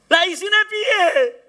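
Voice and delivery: high, loud and slow with a crying break; tearful high wail of worry; despairing cry. Acted. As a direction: wail the question as if at a loss. Despair at empty new church.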